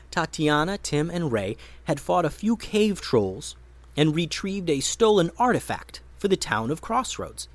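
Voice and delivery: ordinary delivery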